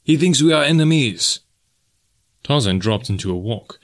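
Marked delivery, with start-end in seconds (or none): 0.0-1.4 higher-pitched, mildly urgent warning; urgent cry. A narrator's reading. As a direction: call out a warning to a friend. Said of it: "cried Deonot".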